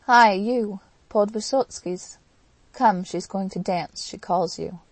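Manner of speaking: shouting through a locked door; loud drunken call to the Poles; rowdy drunken hail. Acted. Mitya calling Poles through door.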